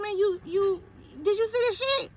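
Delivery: high, stammering outrage; outraged confrontation. Retold or imitated. Mother confronting barber Martin, high stammering outrage. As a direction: confront him, can barely get it out.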